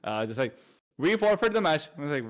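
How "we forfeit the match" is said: higher, louder mock Indian accent on a curt announcement. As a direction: imitate the other team's forfeit message in an Indian accent. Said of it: "he did an Indian accent".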